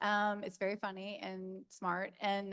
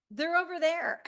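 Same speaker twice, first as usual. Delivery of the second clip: stunned laughing; 12 semitones higher, 8 dB louder than usual. Retold or imitated. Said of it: "I was like".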